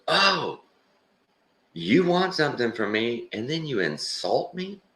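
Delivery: high, indignant mock tirade; going off on him. Man insulted by the homeless guy, mock outrage. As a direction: snap back like an offended jerk. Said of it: "He just starts going off on the guy".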